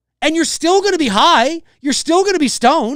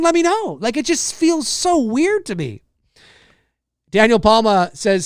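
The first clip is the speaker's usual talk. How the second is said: normal talking voice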